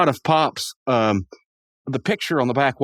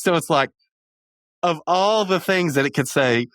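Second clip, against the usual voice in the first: usual speaking voice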